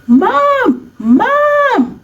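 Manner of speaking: high, loud, desperate call across a distance; child shouting Mom from far away; screaming for mom. Retold or imitated. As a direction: scream for your mother far down the road. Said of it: "And I started screaming".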